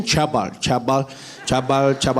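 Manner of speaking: "Chabal" for Trevor, opening with ch and a broad a; mispronounced name. Retold or imitated. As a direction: imitate a guard getting the name wrong as "Chabal". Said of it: "He's like".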